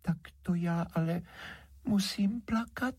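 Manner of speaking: very high, plaintive little voice; small wounded sprite voice; tearful fairy. A narrator's reading. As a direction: pout as if about to cry. Rejected fairy about to cry.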